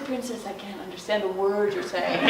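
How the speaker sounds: low, soft frog voice; calm frog. A narrator's reading. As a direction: answer the wailing princess low and evenly. Frog calms the crying princess.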